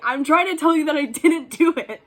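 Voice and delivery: high, loud, indignant retelling; exasperated protest. Retold or imitated. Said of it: "I was so mad at her".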